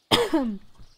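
a quick cough clearing the chest; short cough. Real, not acted. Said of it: "My apologies for that".

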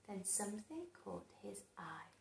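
fast breathy whisper. A narrator's reading. Narrator building suspense, fast whisper.

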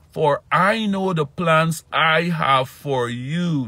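high, slow, loud emphatic preaching. Acted. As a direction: lean into every word of the verse. Repeats verse slower, higher.

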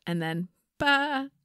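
short high goat bleat. Pretend. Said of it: "Are you ba?".

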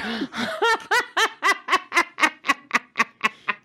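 helpless laughter mid-reading; real laugh. Real, not acted. Laughing while reading story.

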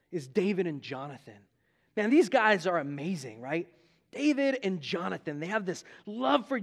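ordinary delivery

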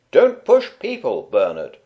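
a mother telling off her pushing son; stern. A narrator's reading. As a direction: scold the boy firmly. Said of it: "said Bernard's mum sternly".

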